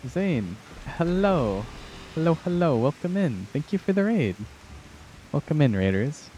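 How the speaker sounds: high, bubbly welcome to the raiders; chirpy greeting to the chat; cute anime streamer. Real, not acted. Greeting incoming raid on stream.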